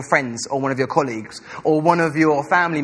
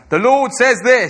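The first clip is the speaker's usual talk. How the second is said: slow, loud mock pulpit voice; soapbox preacher; 6 semitones higher, 7 dB louder than usual. Pastor mocking preaching at work, slow loud soapbox voice. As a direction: proclaim it like a street preacher. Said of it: "getting a little box out and going".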